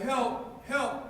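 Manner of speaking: high-pitched storyteller's cry for help, no real volume; mock yell for help. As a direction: call up from a hole, half-voiced. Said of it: "The guy's yelling".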